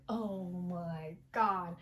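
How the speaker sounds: drawn-out reaction. Real, not acted. Awed reaction to the family scene.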